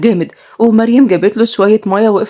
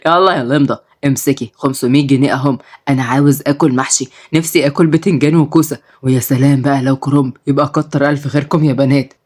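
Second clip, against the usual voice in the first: put-on lower, easygoing old man; old grandpa; 5 semitones lower than usual. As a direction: talk like a fond grandfather, low. Grandpa craving stuffed veg.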